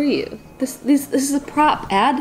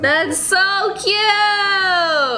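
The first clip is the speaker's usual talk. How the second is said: high, drawn-out squeal of adoration; gushing coo; 8 semitones higher, much slower than usual. Real, not acted. Woman gushing over her cute baby pet, cooing. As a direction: stretch it out high, melting at the cuteness.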